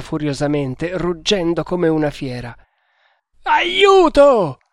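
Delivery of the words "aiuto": long, high, loud scream; drawn-out, full-voiced shriek; howling for help. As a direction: yell it out in desperate panic. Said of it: "tornò a urlare".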